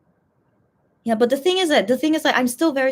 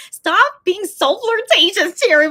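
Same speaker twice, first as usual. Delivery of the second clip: playful mock scolding; 9 semitones higher, 7 dB louder, slower than usual. Real, not acted. Teasing a fan playfully.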